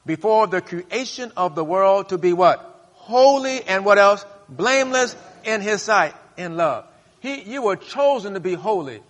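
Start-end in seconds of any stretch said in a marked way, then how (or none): 0.0-6.9 rising, emphatic sermon delivery; fervent pulpit delivery; preaching. Acted. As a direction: preach it out to the congregation. Preaching at full force.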